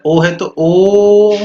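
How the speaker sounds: "ओ" stretched out long and loud; teacher showing the long "ओ" sound; long drawn vowel. Shown as a demonstration. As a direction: draw out "ओ" very slowly. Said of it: "उसको long खींच के बोलना है".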